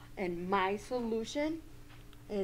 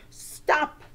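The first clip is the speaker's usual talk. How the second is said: firm stop; 4 semitones higher, 6 dB louder than usual. Shown as a demonstration. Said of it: "and say".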